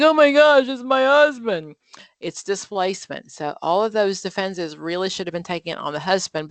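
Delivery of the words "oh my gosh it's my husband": a hammed-up wail, higher and louder; mock crying wife. Teacher imitating the client's tearful outburst. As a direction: act out her sudden sob over the husband. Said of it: "she comes home and she cries".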